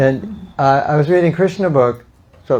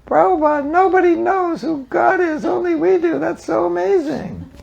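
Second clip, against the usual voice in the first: put-on little kid. Devotee writing to Prabhupada, very high naive child voice. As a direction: gush like a small kid telling a big secret. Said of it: "like this little kid".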